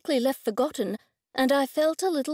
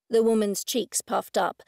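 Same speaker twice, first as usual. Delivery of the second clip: ordinary delivery, nothing marked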